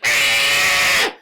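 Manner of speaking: long loud mock shriek; hammed-up wail of a soaked creature; imitated creature scream. Gizmo getting water spilled on him, imitated scream.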